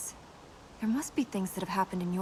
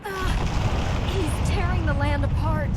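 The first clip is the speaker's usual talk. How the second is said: loud, high alarmed yell; shout; 6 semitones higher, 7 dB louder than usual. Acted. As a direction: shout over the roaring chaos. Shouting over destruction and wind.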